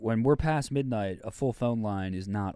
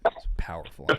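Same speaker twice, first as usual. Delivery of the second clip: breathy whispered aside; 6 semitones lower than usual. Real, not acted. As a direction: whisper it under your breath.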